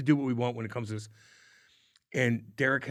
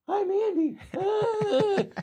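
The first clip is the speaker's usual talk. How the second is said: much higher, put-on goofy voice for Andy; dopey Andy voice; much slower than usual. Mocking Andy coming up the wall in a silly high voice. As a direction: squeak it out like a clueless teammate. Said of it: "Andy's coming up the wall like".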